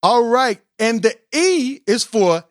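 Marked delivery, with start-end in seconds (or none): none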